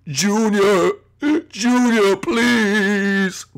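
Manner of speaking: hammed-up sobbing dad voice; tearful pleading. Dad phones Junior for help, mock tearful pleading. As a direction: wail it out, stretching the words.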